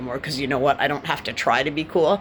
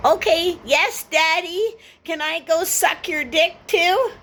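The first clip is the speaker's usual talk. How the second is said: much higher, slow simpering mock voice; mocking girly voice; 13 semitones higher, 3 dB louder, slower than usual. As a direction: ham up a simpering girl. Mocking a woman's reply.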